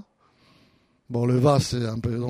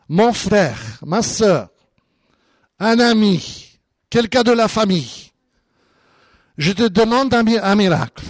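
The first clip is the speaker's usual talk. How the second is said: raised, louder pleading prayer; acted fervent plea; 5 semitones higher, 4 dB louder than usual. Pastor voicing someone asking God for a miracle, fervent.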